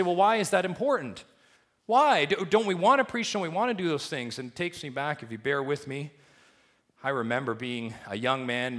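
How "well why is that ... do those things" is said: high, loud, urgent questioning; listener's objection. Retold or imitated. Preacher voicing a churchgoer's objection, high and urgent. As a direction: demand why, voice rising. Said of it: "And you say".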